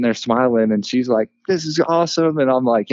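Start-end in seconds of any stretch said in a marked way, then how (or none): 1.5-2.4 higher-pitched imitation of an excited wife. Wife delighted at their son's birth, imitated with mild excitement. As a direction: lightly act out her delight. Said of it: "She gets all excited".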